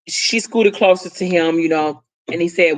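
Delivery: ordinary delivery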